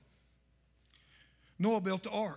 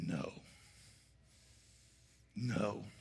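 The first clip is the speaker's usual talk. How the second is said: much lower and much softer, ending in a whisper; God's cold verdict; 14 semitones lower, 10 dB quieter than usual. Retold or imitated. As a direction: pronounce the verdict deep and hushed. God answers the drowning mother.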